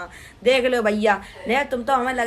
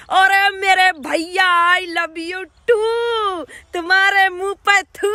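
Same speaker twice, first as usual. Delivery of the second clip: very slow, high, louder fake wail; mock crying; 6 semitones higher, 4 dB louder, much slower than usual. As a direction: drag it out like a hammed-up sob. Mock reply to an I love you comment.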